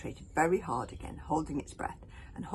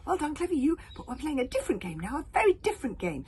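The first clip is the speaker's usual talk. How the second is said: higher, louder cajoling; coaxing boy; 11 semitones higher, 6 dB louder than usual. Acted. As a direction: coax like with a child. Coaxing the hogfly.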